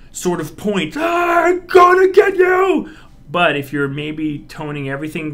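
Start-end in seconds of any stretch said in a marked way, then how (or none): 1.0-2.9 high, loud excited yell; excited villain threat; excited shout. Shown as a demonstration. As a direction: lunge in with a playful yell. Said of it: "I'm really excited".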